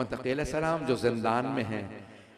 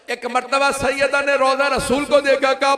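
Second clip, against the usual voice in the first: louder, higher mourning recitation that keeps climbing; full-force preaching; 5 semitones higher, 8 dB louder than usual. Acted. Majlis masaib rising to wailing. (ऊँची, ज़ोरदार मातमी मजलिस की आवाज़)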